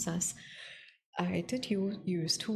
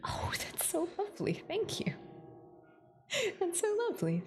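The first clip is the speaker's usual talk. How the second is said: high, touched "so lovely"; delighted; 13 semitones higher than usual. Real, not acted. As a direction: gush with delight. Touched by viewer's gift.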